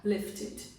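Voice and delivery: "Lifted" with a short i in the last syllable; short ID ending. Shown as a demonstration. Teacher modelling for learners, "Lifted" ending in short "id". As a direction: end "Lifted" on a light "id". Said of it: "Não é ed".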